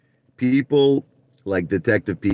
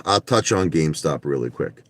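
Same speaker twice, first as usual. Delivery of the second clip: ordinary delivery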